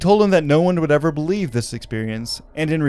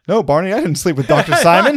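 higher, louder, laughing denial; mock southern Betty; 6 semitones higher, 7 dB louder than usual. Betty denying the affair to Barney, laughing southern voice. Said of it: "giving him a southern accent".

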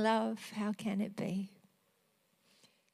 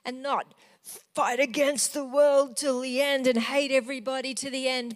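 normal talking voice

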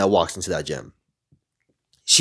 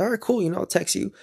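higher, softer acted reply; playing it cool; 10 semitones higher, 5 dB quieter, faster than usual. Man telling the girl he'll text, playing it cool. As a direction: toss it off lightly, pitched up. Acts out his parting line to her.

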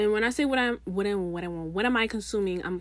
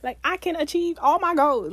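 hyped affirmation; 8 semitones higher, 4 dB louder than usual. Pretend. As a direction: chant it like you believe it.

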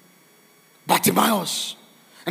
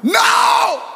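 high, loud burst; emphatic shout; 13 semitones higher, 5 dB louder than usual. Real, not acted. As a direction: cry it out at full force. Climax of fervent prayer.

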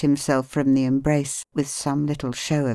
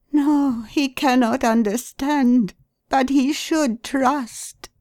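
high, pleading voice of a distraught wife; grieving wife, high and pleading; wife's anguished voice; 10 semitones higher, slower than usual. A narrator's reading. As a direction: voice her raised and aching, slowly. Wife answers Watson.

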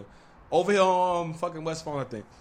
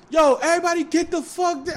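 high-pitched retold warning yell; imitated warning shout; 10 semitones higher than usual. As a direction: call it out high and urgent, as retold. Voices men calling out in raid.

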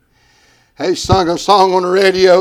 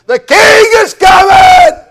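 much higher and louder, shouted; crowd shout; 11 dB louder than usual. Retold or imitated. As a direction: cry it out to the whole crowd. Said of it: "Somebody shouted out".

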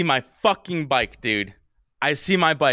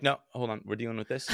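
much softer, offhand brush-off; dismissive cops; 2 semitones lower, 7 dB quieter than usual. Retold or imitated. Cop putting off the bike owner, imitated dismissively. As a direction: imitate an officer who won't be bothered. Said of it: "And they're like".

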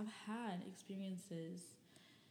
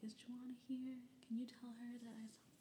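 put-on sweet boy's voice; 5 semitones higher, 4 dB quieter than usual. Boy asking granny at door.

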